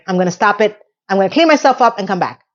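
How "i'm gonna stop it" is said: usual speaking voice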